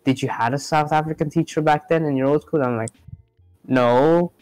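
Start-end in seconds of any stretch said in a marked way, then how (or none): none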